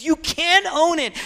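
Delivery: high, impassioned plea; preacher voicing an emotional protest; weeping intensity. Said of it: "he's weeping with emotional intensity".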